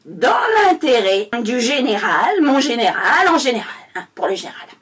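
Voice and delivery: hammed-up proclamation, swelling on the opening words; pompous, stretched-out speech parody; minister's speech redone. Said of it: "refaire le discours du monsieur".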